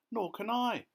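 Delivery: very high, plaintive cry; wail. A narrator's reading. Glowworm who cannot swim, wailing. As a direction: wail it helplessly. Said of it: "wailed the glowworm".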